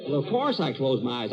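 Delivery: ordinary talk, nothing marked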